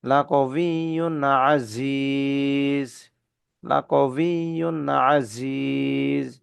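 লাকবিয়ুন Aziz drawn out very slowly; slow chanted tajweed; slow Quranic recitation. Shown as a demonstration. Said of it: "তানবিনের পরে আইন আসছে।".

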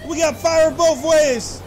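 loud, high panicked whoas; panicked yelling. Pretend. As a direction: yell like fire is closing in. Fire blocks both exits.

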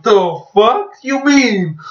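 high, drawn-out incredulous protest; mock outrage. Student facing a twenty-five page test, mock outrage. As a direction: stretch it out in exaggerated disbelief.

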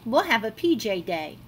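ordinary delivery

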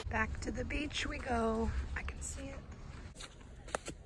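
soft slow whisper to the camera. Real, not acted. Vlogger whispering to viewers about the beach. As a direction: breathe it out, barely voiced.

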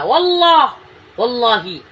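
high, loud cry of an oath; emphatic oath. Real, not acted. Man swearing to what he saw of the prisoners, emphatic loud oath. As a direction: cry out the oath, pitched right up.

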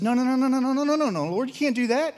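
high, rapid pleading nos; Moses pleading. Retold or imitated. Moses begging God to spare the people. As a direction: beg the Lord to stop.

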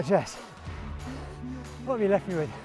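ordinary talk, nothing marked

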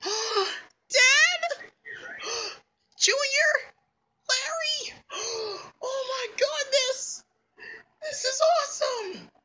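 high-pitched gasping excitement; thrilled, very high voice; overjoyed. Acted. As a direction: squeal with joy at each discovery. Overjoyed reunion with family.

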